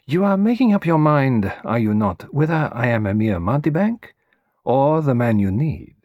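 a lightly voiced foreign detective, amused and probing; Hercule Poirot, teasing. Poirot teasing his young client, lightly voiced. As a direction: play the old detective gently testing his visitor.

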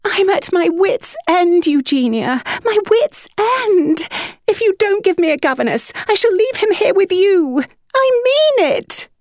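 high, despairing plea rising to a shriek; wailing shriek. A narrator's reading. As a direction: threaten in desperation. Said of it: "The way her voice rose to a shriek".

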